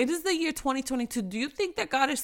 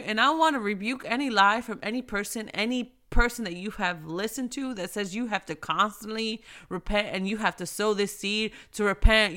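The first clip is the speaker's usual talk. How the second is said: usual speaking voice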